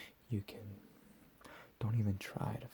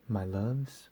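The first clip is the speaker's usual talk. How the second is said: loud mock-tender call; 8 dB louder than usual. Captor addressing his victims.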